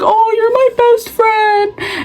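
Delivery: high, drawn-out fake wail; exaggerated tearful whine; mock crying. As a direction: imitate a weepy girl, stretching every word. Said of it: "she was crying".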